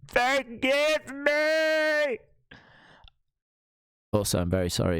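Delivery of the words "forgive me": higher, louder, very slow wail for forgiveness; dramatic plea. Pretend. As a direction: beg forgiveness like a tragic hero. Begging forgiveness, second time.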